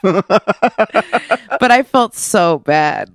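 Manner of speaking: stretched out through laughter; laughing. Real, not acted. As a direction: say it laughing, very slowly. Laughing while remembering.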